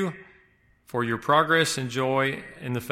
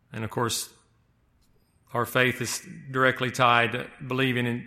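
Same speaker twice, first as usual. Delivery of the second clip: ordinary delivery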